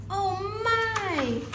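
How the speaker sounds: ordinary delivery, nothing marked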